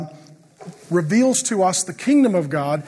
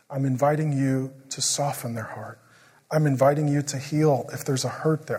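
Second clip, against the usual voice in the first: softer, lower, a gentle prayer; retold soft prayer; 5 semitones lower, 5 dB quieter than usual. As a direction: pray gently over the child.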